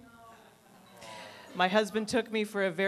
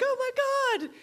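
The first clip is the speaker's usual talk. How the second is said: very high, squealing gasp of friends; hammed-up girlish squeal; mock excitement. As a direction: imitate friends thrilled by big news. Imagined friends reacting to big news.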